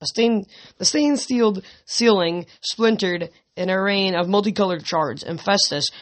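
ordinary talk

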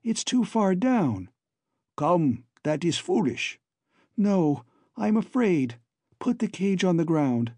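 plead, scared of the height; a touch of fear. A narrator's reading. Babs is afraid of the drop.

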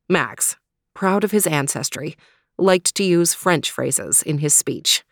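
usual speaking voice

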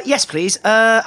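ordinary talk, nothing marked

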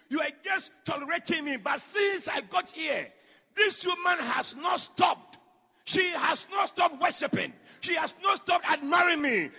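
slow, loud preaching with punched words; full-force preaching. Acted. Preacher retells Jesus' words to the church, loud and slow. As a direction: preach it out to the congregation.

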